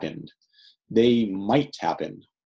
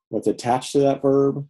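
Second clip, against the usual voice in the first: normal talking voice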